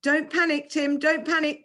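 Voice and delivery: a friend yelling down the cliff; yelled. A narrator's reading. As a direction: yell it down to him. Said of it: "Kelly yelled".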